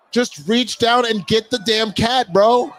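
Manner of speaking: high, loud, slow urging at the screen. Real, not acted. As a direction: yell at the rescuer to grab it.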